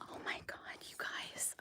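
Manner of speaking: hushed unvoiced whisper. Real, not acted. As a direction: whisper so the animal isn't startled. Hushed so as not to scare animals.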